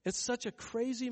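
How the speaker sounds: ordinary talk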